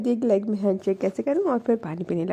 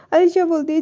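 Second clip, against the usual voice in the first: ordinary delivery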